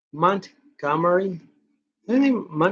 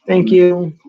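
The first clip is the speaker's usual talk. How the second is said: usual speaking voice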